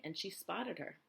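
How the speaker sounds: usual speaking voice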